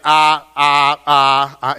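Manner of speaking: loud, drawn-out stammering ahs; stumbling hesitation. Retold or imitated. Mother-in-law stalling a caller with stammered ahs. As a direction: mimic a flustered mom stalling on the phone. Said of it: "I get the stumbling effect".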